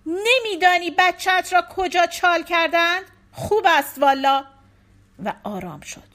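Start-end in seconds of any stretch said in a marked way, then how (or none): 0.1-4.4 loud furious shout, higher; wife shouting at husband. A narrator's reading. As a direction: yell at her husband in disbelief. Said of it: "نسرین داد زد".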